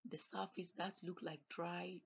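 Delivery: ordinary talk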